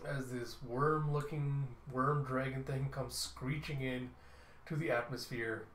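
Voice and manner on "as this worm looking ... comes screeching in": ordinary delivery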